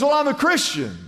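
high-pitched joke character reply; hammed-up jumper; acted earnest answer. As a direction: answer back with voice way up.

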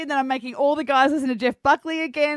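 ordinary delivery

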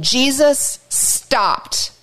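slowly, letting it land; slow and drawn out. A narrator's reading. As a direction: stretch every word. Dramatic retelling.

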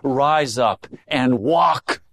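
slow, deliberate command; measured, unhurried pronouncement; very clearly. Acted. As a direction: space out each word as an order. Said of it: "say very clearly to you".

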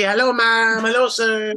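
put-on high, lilting Filipino accent greeting; friendly Filipino accent. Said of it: "so it's very friendly".

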